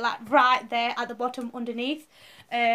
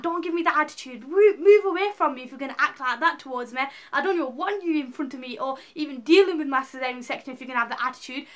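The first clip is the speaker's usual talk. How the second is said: retold angry outburst; 3 semitones higher, 5 dB louder than usual. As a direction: replay the fury of snapping at a rude nurse. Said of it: "I was really angry".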